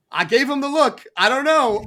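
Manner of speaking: lightly hammed-up suspicious coworker; mock wary admin. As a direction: play the admin spooked by the strange lady. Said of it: "of her being like".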